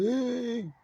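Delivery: high, drawn-out imitation of someone calling; mimicked call. Another voice calls out to him.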